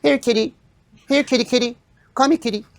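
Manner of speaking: high coaxing kitty call; sing-song call to a cat. Acted.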